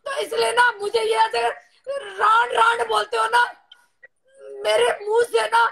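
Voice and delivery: real tearful, upset outburst; hurt, crying protest. Real, not acted. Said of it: "तू क्यों रो रही है".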